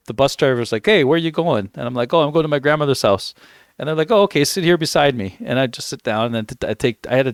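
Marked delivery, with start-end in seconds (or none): none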